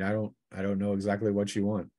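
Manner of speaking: ordinary talk, nothing marked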